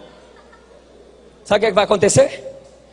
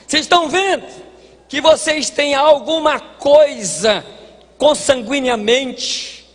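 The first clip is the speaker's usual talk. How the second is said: raised, higher preacher's voice voicing Paul; preaching at full force; 7 semitones higher than usual. Preacher voicing Paul boasting to his brothers, raised and rising.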